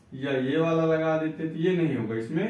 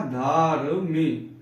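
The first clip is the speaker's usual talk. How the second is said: धार्मिक drawn out, रा heard before मि; slow demo of धार्मिक for students; slow syllable reading; 4 dB louder, much slower than usual. Shown as a demonstration. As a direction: sound out धार्मिक slowly. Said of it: "पहले रा पहले आ रहा है".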